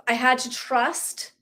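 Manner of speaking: usual speaking voice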